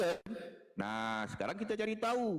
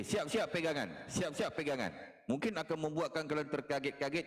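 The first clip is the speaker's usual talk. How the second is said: normal talking voice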